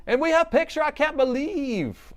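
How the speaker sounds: high, loud and slow with disbelief; triumphant squeal of victory; excited. Real, not acted. Triumph when laptop shows picture.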